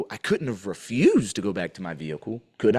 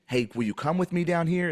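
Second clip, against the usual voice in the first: higher-pitched questioning example; asked as a request; 6 semitones higher than usual. Shown as a demonstration. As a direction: voice it as a polite invitation to come along. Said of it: "Is it like a question?".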